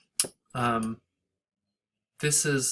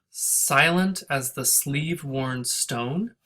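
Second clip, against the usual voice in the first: "Silent as the sleeve-worn stone" slow, with many heavy beats; heavy stresses; slower than usual. Shown as a demonstration. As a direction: lean on every strong syllable. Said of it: "this line has a lot of stresses".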